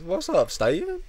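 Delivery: high, loud country drawl; country accent. Retold or imitated. Customer teasing a store clerk, country accent. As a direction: greet him in a put-on country accent. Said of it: "He's got a real country accent".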